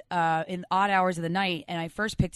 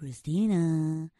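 creepy caller voice; 3 semitones lower, much slower than usual. Retold or imitated. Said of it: "the voice did not sound very manly".

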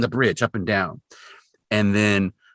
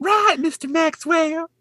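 slow, very high hammed-up Southern accent; slower than usual. Shown as a demonstration. Co-host correcting the retelling, exaggerated Southern accent. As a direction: draw it out in a squeaky-high Southern drawl. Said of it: "No, she says".

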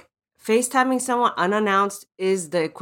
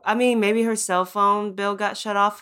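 normal talking voice

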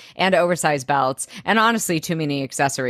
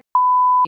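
shrieking laugh; high squeal of laughter; 6 dB louder than usual. Real, not acted. Laughing at a tweet about Serena's name, a high shriek. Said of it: "It made me laugh so hard".

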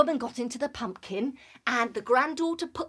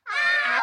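wolf howl; 4 dB louder than usual. Acted. Wolf's howl.

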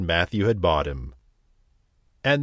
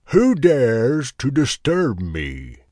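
slow, loud ogre roar; 4 semitones higher, 5 dB louder, slower than usual. Acted. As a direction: boom it out like a fierce ogre. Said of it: "roared a voice".

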